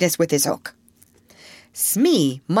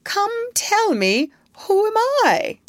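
ingratiating; 3 dB louder than usual. A narrator's reading. Hook begs the voice for an answer, ingratiatingly. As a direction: coax sweetly in a lifted voice. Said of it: "a more ingratiating manner".

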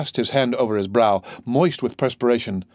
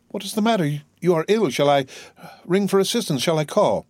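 high-pitched, anxious questions; worried, higher-voiced urgency; alarmed concern; 7 semitones higher than usual. A narrator's reading. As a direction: offer help urgently, pitch jumping up. Dantès alarmed that Villefort is ill.

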